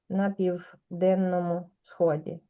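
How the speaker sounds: на південному сході drawn out slowly, each syllable clear; slow careful pronunciation. Shown as a demonstration. Teacher slowing down so students hear the ending. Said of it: "agregar un poco para que se entienda mejor".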